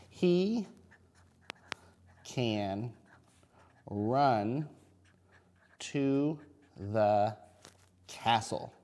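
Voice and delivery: slow, word-by-word dictation; reading to a toddler. Shown as a demonstration. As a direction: say each word slowly as you write it. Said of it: "teacher dictates story while writing".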